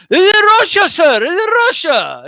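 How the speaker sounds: loud, firm this is Russia; mock Russian desk clerk. Hotel clerk snapping at the English boxer, loud and firm. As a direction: shut the foreign guest down. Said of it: "they're just like".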